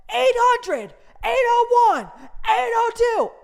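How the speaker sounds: high, loud shouted number calls; calling numbers. Retold or imitated. Guard shouting ticket numbers across the waiting room.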